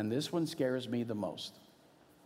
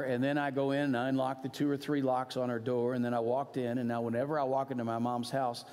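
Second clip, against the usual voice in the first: ordinary delivery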